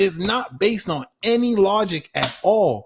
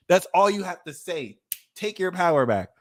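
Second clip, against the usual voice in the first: ordinary talk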